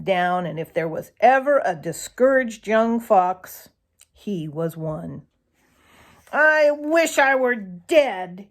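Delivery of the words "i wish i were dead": slow despairing moan. A narrator's reading. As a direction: moan it out, hopeless. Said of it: "he moaned".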